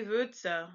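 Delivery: Würze with a rounded vowel like the U in "hurt"; German ü sound. Shown as a demonstration. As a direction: teach Würze slowly, fronted rounded ü. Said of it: "sounds like the U in hurt".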